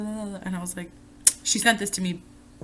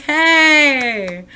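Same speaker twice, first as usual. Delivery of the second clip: loud, high happy shout; a cheer of recognition; cheering at the guy who also has a crazy mom, loud and high; excited cheer; 6 semitones higher, 9 dB louder than usual. Retold or imitated. Happy about shared crazy moms.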